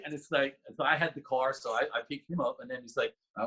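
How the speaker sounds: ordinary talk, nothing marked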